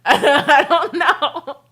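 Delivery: words stretched through laughter; laughing too hard to finish. Real, not acted. To a friend about her sister, laughing helplessly.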